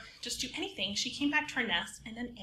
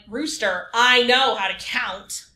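louder, slower, indignant; indignant snap; 12 dB louder, slower than usual. Acted. Chicken indignantly insists to Rooster she can count, loud and slow. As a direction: tell him off, offended.

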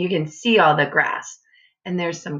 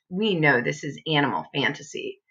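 ordinary delivery, nothing marked

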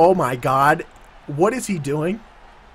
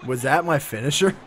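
normal talking voice

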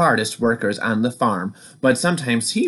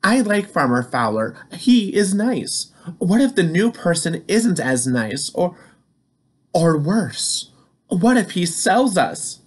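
worried Corey; 6 semitones higher than usual. Acted. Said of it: "said Corey with the worried look".

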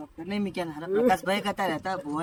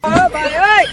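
loud, high shout for attention; calling out; 8 semitones higher, 13 dB louder than usual. Acted. Helper calling out to the man at the doctor's visit, loud holler. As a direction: holler to get him to listen.

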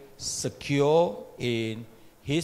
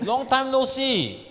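hammed-up greeting; 11 semitones higher, 4 dB louder, slower than usual.

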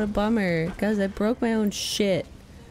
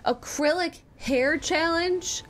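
slow, higher disbelieving question; incredulous question; 7 semitones higher, slower than usual. Real, not acted. Streamer reacting to a viewer's challenge idea, incredulous. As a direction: read it out in drawn-out disbelief. Reading out a viewer's outrageous suggestion.

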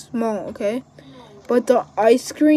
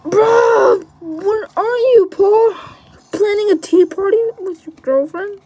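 mocking; 8 semitones higher, 4 dB louder than usual. Mock taunt at teammate.

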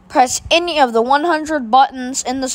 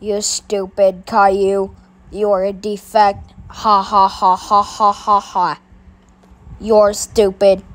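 mocking bully voice; 4 semitones lower than usual. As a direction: sneer at him like a schoolyard bully. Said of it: "having Rico come and badmouth you".

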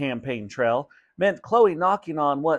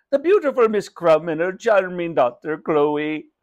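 effusive shopkeeper, pitched up; gushing greeting in a raised character voice; Raj; 4 semitones higher, 4 dB louder than usual. Acted. Shopkeeper flatters mother and daughter, effusive.

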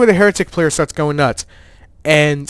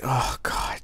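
exasperated whisper; 5 semitones lower, 12 dB quieter than usual. Retold or imitated. Gamer whispering to himself as the opponent taunts, exasperated whisper. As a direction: whisper it, fed up and done. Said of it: "I was just like".

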